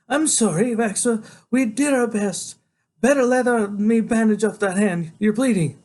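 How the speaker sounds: high, slow, sorrowful character voice; distressed woman doctor. A narrator's reading. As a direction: apologise in a high, upset woman's voice. Doctor consoles boy in story.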